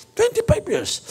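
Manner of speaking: very high squeal; squeaky answer. Retold or imitated. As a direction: squeak it out proudly. (matinis na sagot)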